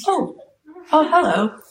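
high-pitched startled greeting. Retold or imitated. Actress hears Spielberg on phone, high startled hello. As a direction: imitate being caught off guard on the phone.

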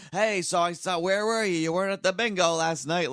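put-on high, loud yelling old man; imitating old man yelling. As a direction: shout it at a deaf old lady. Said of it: "like, yelling".

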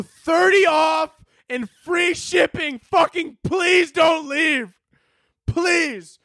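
high, slow, shouted mock begging; desperate pleading. Subscription site begging a leaving customer to stay, slow desperate shout. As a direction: beg him not to leave, hammed up.

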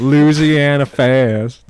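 high, loud, slow Southern ad voice; Southern accent ad tagline; Southern ad drawl. Retold or imitated. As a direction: stretch Louisiana like the ad. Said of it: "lady from the Popeyes commercials".

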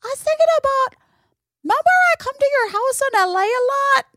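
put-on high, chatty little kid voice; little boy voice. Nephew tells his aunt what he's up to, in a high kid voice. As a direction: play a five-year-old wandering the house. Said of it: "He's like".

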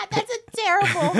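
loud, high-pitched laughing objection; cracking up while scolding the joke; laughing protest. Real, not acted. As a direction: say it through helpless laughter. Cracking up at segment name.

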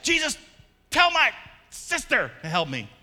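mock-angry complaint; mad Martha. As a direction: snap at Jesus like an overworked sister. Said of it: "she's so mad".

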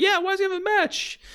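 very high, squeaky whining manager voice. Retold or imitated. Manager whining about his rival's match. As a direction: whine it up like a cartoon manager. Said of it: "[name]'s complaining".

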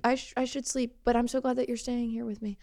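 a frail patient, glad he came; weak girlfriend voice. Acted. Plays the girlfriend in hospital bed.

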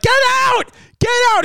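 a high, shrill mock scream. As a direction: shriek it like a woman in labor. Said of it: "Vinny was like".

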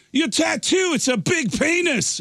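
hammed-up blunt pointing out; mock exclaim. Singer blurting out to the drummer, high and emphatic.

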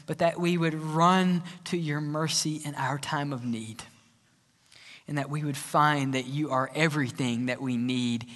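low, soft closing prayer; hushed pastor's prayer to close worship. Acted. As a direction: pray it quietly and reverently. Closing prayer before sermon.

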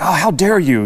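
lightly hammed-up outrage; mock indignation. Mimicking people who condemn business owners, in mock indignation. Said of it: "They'll be like".

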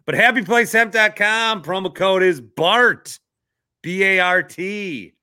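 loud, high ad pitch; promo code plug. Real, not acted. Host selling his sponsor code to listeners, punchy ad pitch.

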